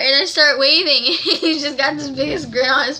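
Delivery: laughing through the words. Real, not acted. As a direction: talk while laughing. Laughing while recalling.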